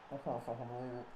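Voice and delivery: very soft, stunned disbelief; stunned mutter. Real, not acted. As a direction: say it under your breath, crushed. Robbed of a winning volley.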